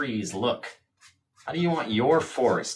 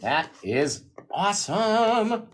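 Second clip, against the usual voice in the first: touched; 8 semitones higher, much slower than usual. Real, not acted. Moved, about to tear up.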